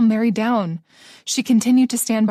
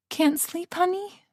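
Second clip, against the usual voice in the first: higher, soft and cooing; sweet friend voice; 9 semitones higher, 5 dB quieter, slower than usual. Acted. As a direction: coo down the phone to a friend. Ruby answering the phone.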